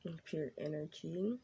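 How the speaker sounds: soft, hushed prayer; reverent, softly spoken invocation; opening prayer. Acted. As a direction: pray quietly before the reading. Opening prayer before tarot read.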